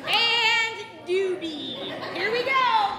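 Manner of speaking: playful shout. Real, not acted. Sneaking up to scare.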